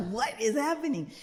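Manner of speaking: high-pitched, half-laughing disbelief; playfully baffled outcry; mock bewilderment. Child self lost in sister's explanation.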